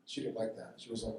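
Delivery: usual speaking voice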